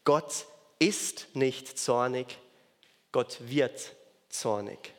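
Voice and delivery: "ist" and "wird" set apart slowly; slow, deliberate contrast of "ist" and "wird"; slow contrastive stress. Shown as a demonstration. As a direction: weigh "ist nicht zornig" against "wird zornig". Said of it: "das ist ein wichtiger Unterschied".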